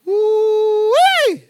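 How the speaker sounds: long, very high, loud whoop; excited whoop. Real, not acted. Preacher reacting to his own point, loud high whoop. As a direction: holler it out in delight.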